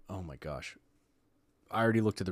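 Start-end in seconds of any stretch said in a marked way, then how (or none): 0.1-0.8 quiet aside. Real, not acted. Reacting to study results, aside.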